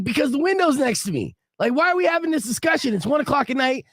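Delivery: high, exasperated snapping; mad and irritated. Retold or imitated. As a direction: act out arguing crossly at bedtime. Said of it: "I'm mad and irritated".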